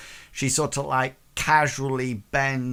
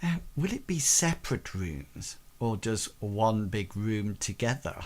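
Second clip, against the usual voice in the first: lower, softer hostess voice; coy tavern hostess; 3 semitones lower, 5 dB quieter than usual. Acted. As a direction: ask it softly and suggestively. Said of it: "Maggie sort of, like, says".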